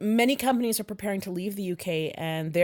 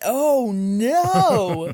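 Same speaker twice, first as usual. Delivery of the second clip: mock dismay; 4 semitones higher, 7 dB louder than usual.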